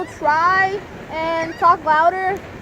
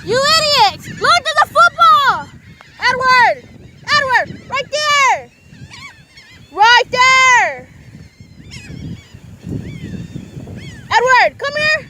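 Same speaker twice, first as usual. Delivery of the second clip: higher, louder shouting across the beach; screaming for Edward; 8 semitones higher, 4 dB louder, slower than usual. Real, not acted. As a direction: yell to get Edward's attention. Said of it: "Sorry for the screaming".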